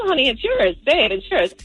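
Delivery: ordinary delivery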